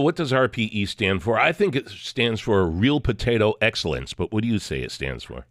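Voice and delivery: ordinary talk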